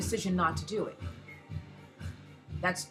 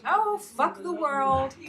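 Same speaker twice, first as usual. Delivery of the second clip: mocking Republicans; 6 semitones higher, 5 dB louder, much slower than usual. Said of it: "Republicans are pretty much".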